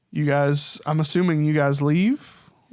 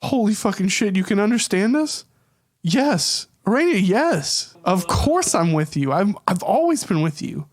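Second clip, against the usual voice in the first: higher, louder astonished joy; excited spider; 5 semitones higher, 3 dB louder than usual. Acted. Friend in spider form, overjoyed to be understood. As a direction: burst out amazed and glad. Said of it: "he goes".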